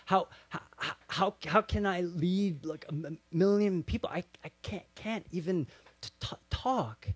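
halting, repeating words and first sounds; imitated stutter. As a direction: stammer through it, acting overwhelmed. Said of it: "He's stuttering".

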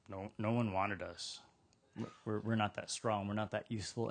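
soft, low admission of being unwanted; dejected, self-effacing. Acted. Leftover workers answer the landowner, dejected and quiet. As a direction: trail it out quietly, as if apologizing for yourself.

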